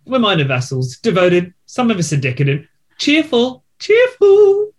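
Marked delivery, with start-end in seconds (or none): 3.0-3.6 cheerful. Acted. Lists the vassals' qualities, acting cheerful. 3.8-4.7 very high-pitched "cheerful"; exaggerated cheerful.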